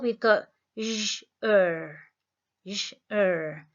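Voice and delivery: teacher isolating the zh sound; sounding out syllable. Shown as a demonstration. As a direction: say j then er as separate sounds. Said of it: "in the second syllable".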